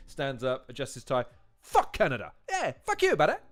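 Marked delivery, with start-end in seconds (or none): 1.6-3.4 lightly voiced cartoon diplomat, the second line jumping much higher and louder; a hint of the ambassador's blunt outburst; South Park ambassador. Quoting the American ambassador's reply at the meeting, mock cartoon voice. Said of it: "They go to the American ambassador".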